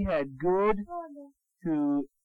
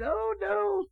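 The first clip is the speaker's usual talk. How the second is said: squeaky piggy voice; 12 semitones higher than usual. Acted. Piggies answering the wolf.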